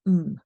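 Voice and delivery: I'm reduced to a short hummed mm. Shown as a demonstration. Teacher shows I'm shrinking to mm. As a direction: hum I'm as mm. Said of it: "just turns into".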